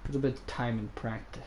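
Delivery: low-key, soft murmur; subdued, softly spoken patience; quiet self-reassurance. Real, not acted. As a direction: say it softly to yourself. Calm remark before mocking it.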